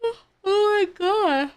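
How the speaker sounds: yawning through the words. Real, not acted. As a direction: let a big yawn stretch it out. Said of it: "I can't stop yawning".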